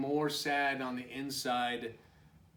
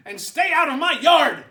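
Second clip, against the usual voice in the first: 1 furious shout; 13 semitones higher, 18 dB louder, faster than usual. Acted. Hank yelling at visitors.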